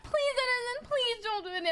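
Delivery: high-pitched, whiny panicked begging; mock-tearful panic; panicked pleading. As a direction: reenact a desperate plea, voice shooting way up. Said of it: "starts hitting panic levels".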